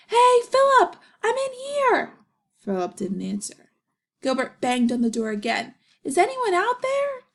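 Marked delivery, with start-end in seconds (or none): 0.1-2.1 high, loud yell through a door. Acted. Gilbert locked in restroom, yelling for Philip. 6.0-7.2 high, loud call for help; shouting. Acted. Gilbert trapped in bathroom, shouting high. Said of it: "he shouted".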